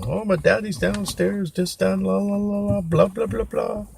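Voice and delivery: high-pitched mocking singsong chatter; mock gushing that runs off into blah-blah; gushing kid brother. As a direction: imitate an adoring little brother, way up high. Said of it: "he's crazy about him".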